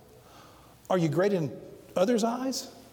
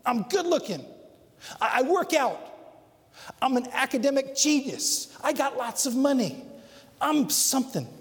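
higher, pushy bragging about himself; bragging show-off; 6 semitones higher than usual. Retold or imitated. Preacher voicing the world, a higher boastful brag. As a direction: brag like someone starving for praise. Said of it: "This world says".

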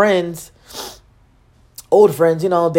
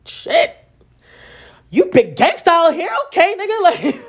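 higher, mock-impressed teasing; 10 semitones higher, slower than usual. To the eleven-time felon, high mock-impressed teasing. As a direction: tease him as a big gangsta. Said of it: "I'm just like".

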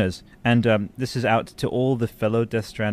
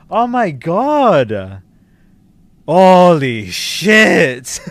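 real stunned yell, higher and louder, drawn out; 7 semitones higher, 10 dB louder, much slower than usual. Real, not acted. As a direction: shout it out in total shock. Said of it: "sorry for yelling".

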